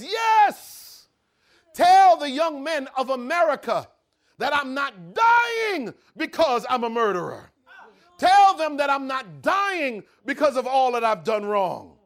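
high, loud, slow declaration; condemned man addressing the cameras; proclaiming last words. Acted. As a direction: proclaim it to the nation.

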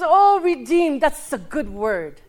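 a drawn-out, loud, laughing "oh, redeemed"; a church lady playing up her delight; surprised delight. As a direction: mimic her gushing surprise, stretched out. Lady reacts to his answer.

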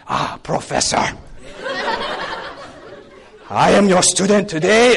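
mock-reverent student voice; bow to the professor with your voice; hammed-up deference; exaggerated respect.

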